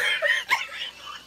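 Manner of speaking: very high squealing laugh; uncontrollable laugh. Real, not acted. Laughing at own Christmas joke, high helpless laugh. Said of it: "Just me constantly laughing".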